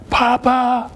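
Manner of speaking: high, held child's call, imitated; grandson's yell. Grandson summoning his grandfather from bed, imitated high yell. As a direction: mimic a boy calling out for grandpa. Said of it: "and here he goes".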